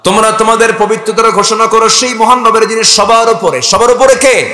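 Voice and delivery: high, loud rousing declamation; full-force preaching. Acted. Preacher asking the crowd who is above all, high and loud. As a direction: push it out to the whole crowd.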